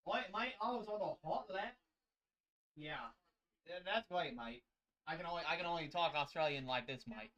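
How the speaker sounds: hammed-up Australian accent, swooping pitch; attempted Australian accent. Shown as a demonstration. Booth commentator showing his best try, amateur Australian accent. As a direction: have a playful stab at Australian. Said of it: "That was my best try at an Australian accent".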